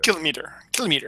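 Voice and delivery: matter-of-fact "Kilometer", stress up front; kilometer stressed on KILL. Shown as a demonstration. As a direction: quietly try "Kilometer" with KILL first. Practising the corrected pronunciation.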